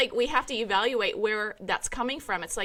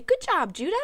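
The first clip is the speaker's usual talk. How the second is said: cheery praise; 8 semitones higher, 4 dB louder than usual. Retold or imitated. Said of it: "And I would say".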